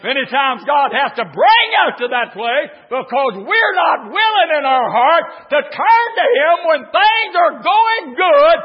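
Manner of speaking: loud, high preacher's cry; full-throated revival preaching; preaching at full force. Acted. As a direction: build the congregation up, shouting.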